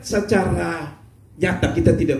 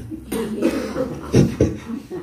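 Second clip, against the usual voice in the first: breathy laugh; 7 semitones higher than usual. Real, not acted. Preacher laughing at own joke.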